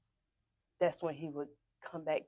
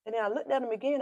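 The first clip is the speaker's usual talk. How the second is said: usual speaking voice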